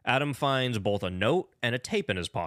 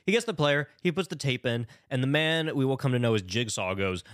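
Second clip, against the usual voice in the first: usual speaking voice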